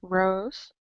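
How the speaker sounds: ordinary talk, nothing marked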